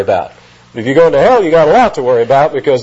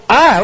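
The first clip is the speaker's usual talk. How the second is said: "I" pitched high and held; single high, stressed "I"; emphatic I; 9 semitones higher, 3 dB louder than usual. Acted. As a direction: punch the pronoun "I" well up in pitch. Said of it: "another emphatic pronoun".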